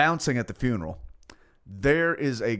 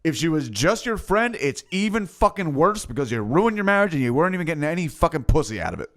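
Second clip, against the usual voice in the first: blunt confrontation; 6 semitones higher than usual. Retold or imitated.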